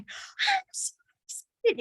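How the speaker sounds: put-on very high, slow squeal of a little kid; excited little kid. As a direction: squeal it like a thrilled child. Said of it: "a little kid who's like".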